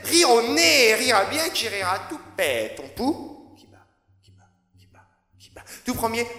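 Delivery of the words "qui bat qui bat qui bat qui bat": whisper=43. Acted. Whispered refrain in poem.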